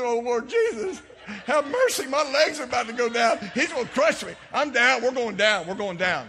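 loud, high, laughing cry of being crushed; laughing mock alarm, legs giving way; mock panicked cry. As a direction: ham up the panic as you go down under his weight. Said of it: "I said".

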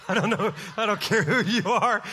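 chuckling through the words; laughing. Real, not acted. Preacher enjoys his own joke before the congregation, laughing. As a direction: let laughter shake the words as you talk.

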